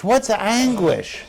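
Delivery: ordinary talk, nothing marked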